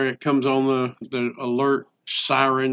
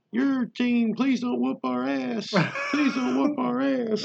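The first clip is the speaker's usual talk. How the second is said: high, softer pleading cheer; 7 semitones higher, 4 dB quieter than usual. Pretend. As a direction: beg the other team in a chant. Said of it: "What are some good cheers".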